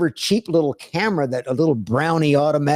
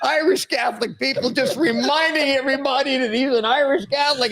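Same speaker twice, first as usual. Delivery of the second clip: ordinary talk, nothing marked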